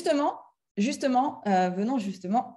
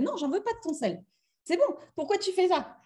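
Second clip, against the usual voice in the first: higher, huffy refusal; sulky refusal; 8 semitones higher, 5 dB quieter than usual. Pretend. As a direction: refuse it like a hurt friend. Dispute jouée, elle refuse le sel. (vexée, refuse le sel d'une voix aiguë)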